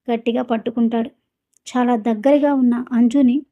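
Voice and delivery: ordinary delivery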